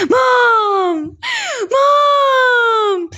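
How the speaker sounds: high, drawn-out tattletale cry; long, high-pitched mock-distressed call; shouting for mom. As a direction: wail for mom like a tattling kid.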